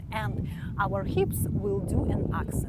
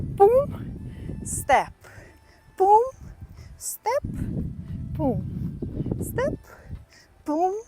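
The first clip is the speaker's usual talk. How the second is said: rhythmic step-boom calls, some very high; calling the steps; 7 semitones higher, much slower than usual. Shown as a demonstration. Teacher calls steps and hip hits in rhythm. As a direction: sing out step and boom in time.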